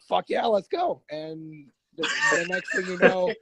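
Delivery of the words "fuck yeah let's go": eager sergeant. Retold or imitated. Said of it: "so he was like".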